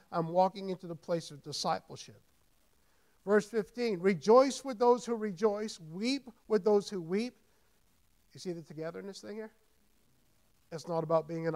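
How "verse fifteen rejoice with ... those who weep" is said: loud emphatic reading, slowing at the end; preacher reading Romans to the congregation; scripture proclamation. Acted. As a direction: lay out each line of the verse firmly.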